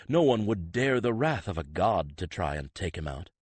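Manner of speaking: ordinary delivery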